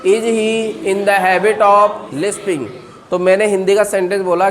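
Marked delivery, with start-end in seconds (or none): 0.1-2.7 very slow, careful question; slow model question. Shown as a demonstration. Teacher drills the question very slowly for the class. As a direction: stretch it out for repetition. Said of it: "बोलिए".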